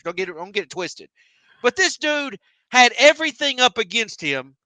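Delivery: usual speaking voice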